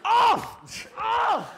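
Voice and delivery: high, loud barked command; sharp acted yell; embarrassed dog owner. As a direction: shout the dog down in a mock panic. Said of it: "owner of the dog gets embarrassed".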